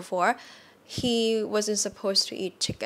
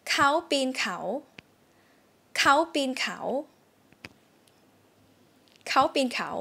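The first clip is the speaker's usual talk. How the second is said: dictation sentence; 5 semitones higher, much faster than usual. Shown as a demonstration. Teacher reading a test sentence for students. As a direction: read เขาปีนเขา deliberately. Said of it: "I'm gonna say a sentence".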